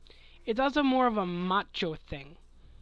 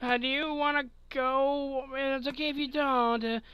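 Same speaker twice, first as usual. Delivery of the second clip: put-on panicky young guy; 6 semitones higher than usual. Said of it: "Instead of when we're younger".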